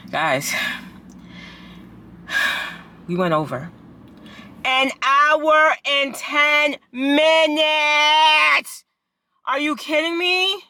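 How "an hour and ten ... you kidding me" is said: high, drawn-out loud outburst; exasperated disbelief. Real, not acted. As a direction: stretch the words in outraged disbelief. Outraged that class ran over.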